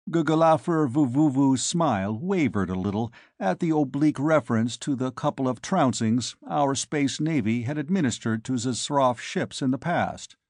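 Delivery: ordinary delivery, nothing marked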